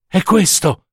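high-pitched cry of recognition. A narrator's reading. Mother recognises her child's heart among the flowers, crying out. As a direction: call it out in sudden recognition. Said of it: "gridò".

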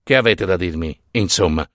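low, restrained impatience; contained seething. A narrator's reading. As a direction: ask it holding the anger down.

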